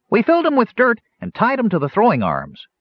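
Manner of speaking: put-on high, loud young gnome voice; eager gnome. A narrator's reading. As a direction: blurt it out eagerly. Gnome Fitter speaks.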